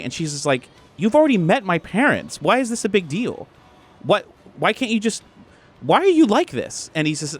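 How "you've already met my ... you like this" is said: high, pleading frustration; acted lovers' quarrel, high-pitched; exasperated girlfriend. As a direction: demand an explanation, pitch climbing. Girlfriend argues with boyfriend.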